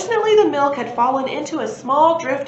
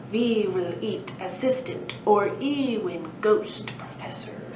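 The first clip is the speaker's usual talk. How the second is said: creepy hungry wumpire. Acted. Wumpires describe their hungry breakfast.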